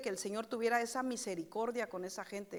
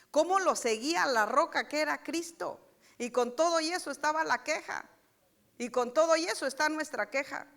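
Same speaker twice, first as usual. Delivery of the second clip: louder, rising preacher's push; preaching with force; 4 semitones higher, 6 dB louder than usual. Acted. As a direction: drive it home to the congregation. Predicación con fuerza. (predicando con fuerza, más fuerte)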